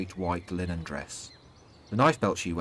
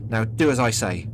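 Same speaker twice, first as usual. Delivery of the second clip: louder stern order; snapping; 3 semitones higher, 6 dB louder than usual. A narrator's reading. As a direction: give a firm, irritated command. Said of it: "he snaps".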